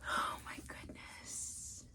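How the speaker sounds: soft stunned whisper; awed whisper. Real, not acted. Stunned by the book, whispering in awe. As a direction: barely breathe it.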